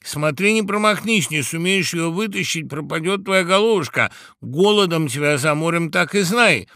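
high, loud mock threat; shouted warning. A narrator's reading. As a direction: goad him loudly from the crowd. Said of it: "закричали опять птицы".

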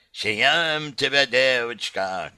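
slow, growling big bad wolf. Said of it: "Да как и зарычит".

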